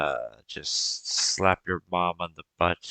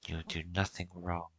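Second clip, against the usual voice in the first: tender comfort; 7 dB quieter than usual. Acted. GM as mother comforting son.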